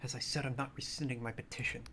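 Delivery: half-whispered, restrained insistence; sharp whisper. Acted. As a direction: drop the voice to a pointed undertone. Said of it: "lowers her voice to a sharp whisper".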